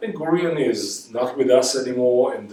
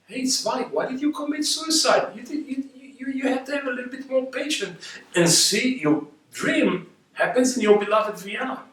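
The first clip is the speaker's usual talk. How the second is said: high-pitched, pleading call to Zweig; pleading address; 12 semitones higher than usual. Pretend. Speaking to Zweig across time, high and pleading. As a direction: call out to him, gently scolding, voice lifted high.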